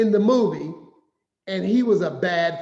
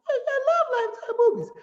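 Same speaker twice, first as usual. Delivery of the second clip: mock high voice. Mocking his own eager claim.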